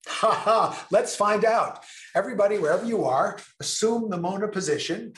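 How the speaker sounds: high, fast and eager; rally the crowd playfully; excited. Real, not acted. Excited call to audience.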